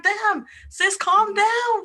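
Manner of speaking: high-pitched, mock exasperated telling-off; exasperated plea. Podcaster mock-scolding an oversharing Facebook auntie, exasperated plea.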